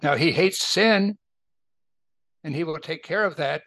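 normal talking voice

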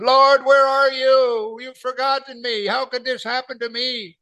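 high, pleading cry to the Lord, slowed at first; desperate cry. Retold or imitated. Believer crying out to God in a test, high pleading wail. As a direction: wail it out like someone abandoned.

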